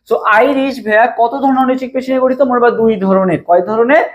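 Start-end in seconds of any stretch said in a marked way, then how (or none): none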